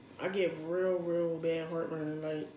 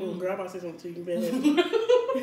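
fast, laughing, louder at end; 6 dB louder than usual. Real, not acted. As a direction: rush it through laughter. Laughing threat to friend.

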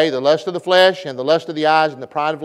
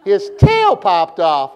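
voice going up, emphatic; 5 dB louder, much slower than usual. Shown as a demonstration. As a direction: leap up hard on "tail", fall on "off". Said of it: "You gotta go up now".

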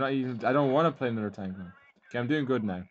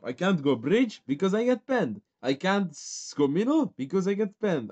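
frustrated rant; 6 semitones higher, 4 dB louder than usual. Real, not acted. Frustrated rant about the tank.

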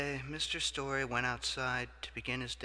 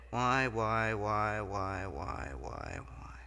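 1 whiny fading why; much slower than usual. Acted. Whining why on a falling voice.

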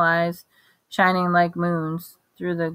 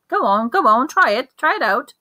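higher, louder coaxing; eager urging; 7 semitones higher, 5 dB louder than usual. A narrator's reading. Wandmaker pushing Harry to try the wand, eager. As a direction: hurry the boy along.

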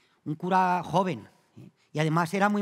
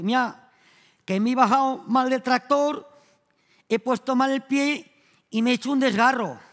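whining patient; 5 semitones higher, 4 dB louder than usual. Retold or imitated. Nemesio voiced in joke.

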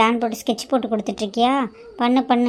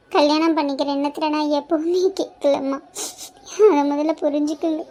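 pleading through tears; tearful plea. Acted. Elder daughter pleads with her stepmother, crying. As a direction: cry while you beg her to understand.

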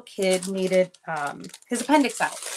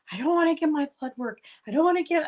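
higher, faster mock whine of a scared boy; whiny kid; 6 semitones higher, 5 dB louder, faster than usual. Son at bedtime fearing blood test, mock whine. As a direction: plead like a child dreading blood work.

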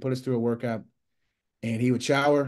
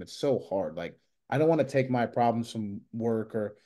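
ordinary delivery

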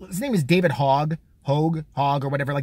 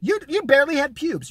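ranting; 8 semitones higher, 3 dB louder than usual. Real, not acted. Ranting at Hogg.

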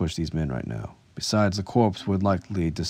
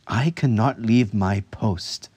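slow, raised battle-sister voice; rumbling refusal; 4 semitones higher, 4 dB louder, slower than usual. A narrator's reading. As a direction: refuse stolidly from behind a visor. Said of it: "she rumbled from behind her visor".

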